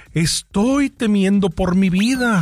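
slow, high, self-pitying whine; mock victim voice. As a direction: ham up the poor frightened lady. Woman plays victim before judge.

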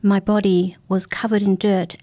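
usual speaking voice

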